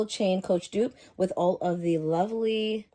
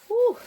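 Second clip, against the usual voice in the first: very high, loud "whew"; sharp high gasp of relief; delighted whew; 7 dB louder than usual. Real, not acted. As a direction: let out a quick squeaky whew of delight. Reacting to the new bag.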